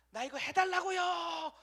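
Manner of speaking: high, loud, slow insistent yell; demanding shout. Pretend. Believer twisting God's arm in prayer, slow high shout. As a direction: wrench the request out of God.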